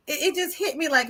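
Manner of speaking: ordinary delivery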